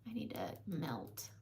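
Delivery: soft unvoiced whisper. Real, not acted. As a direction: breathe it under your breath. Whispered aside while blending.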